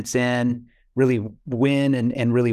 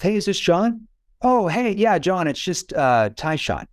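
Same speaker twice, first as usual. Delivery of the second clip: put-on higher, easygoing greeting like an old friend; casual phone opener; 6 semitones higher than usual. Shown as a demonstration. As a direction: sound like someone they already know, relaxed and high. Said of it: "can you break down the tonality that you're using there?".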